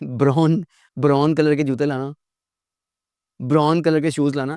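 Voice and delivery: ब्राउन said as "brawn"; imitated mispronunciation. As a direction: mimic learners turning ब्राउन into "bron". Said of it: "no भाई, नहीं भाई". (ब्राउन को "ब्रॉन" बोलने की नकल)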